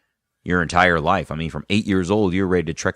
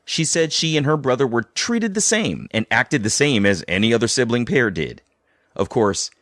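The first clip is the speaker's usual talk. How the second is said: scripted host narration, slightly raised pitch; podcast narration; 4 semitones higher than usual. A narrator's reading. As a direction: read it like a documentary voiceover.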